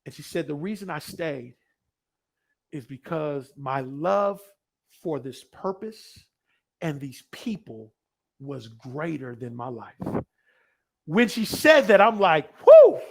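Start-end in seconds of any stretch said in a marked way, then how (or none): 0.5-9.9 slow, low, softened answer; solemn testimony. Retold or imitated. Retold woman's solemn answer. 12.7-13.0 high, loud "whoo"; sudden piercing cry of amazement; amazed whoop. Retold or imitated. Own amazed reaction retold.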